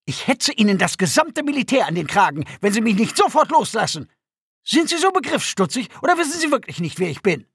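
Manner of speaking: furious high-pitched tirade; Dalartan. A narrator's reading. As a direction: threaten your captor, outraged and self-important. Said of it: "Dalartan war wütend". (wütende, hohe Schimpftirade)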